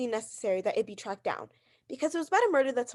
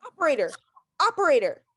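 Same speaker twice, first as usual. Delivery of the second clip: calling out; 3 semitones higher, 5 dB louder than usual. Acted. Woman calls out for operator.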